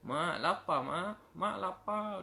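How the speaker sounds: put-on a small child begging for food; hungry child. Hungry child begs mother.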